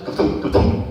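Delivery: low, punchy double thump noise; deep percussive ka-thump sound effect; imitated car thumps. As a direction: voice the wheels bumping over something. Imitating car running over bumps.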